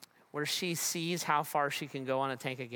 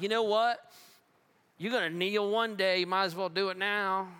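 mock threat; 3 semitones higher, 4 dB louder than usual. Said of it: "It's not a threat".

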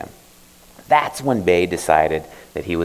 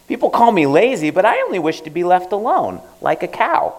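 put-on higher youthful boy voice; boy's voice; 11 semitones higher than usual. Bae telling the old man his wish, higher boy voice. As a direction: tell it like a sulky boy. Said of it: "tell the old man his story".

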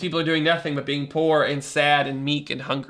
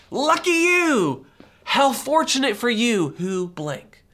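high, slow, gushing "Lucky you!"; excited exclamation; 6 semitones higher, 4 dB louder, slower than usual. Shown as a demonstration. Pastor showing what makarios means, gushing exclamation. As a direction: burst out like you're thrilled for them. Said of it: "is the way that it would say it".

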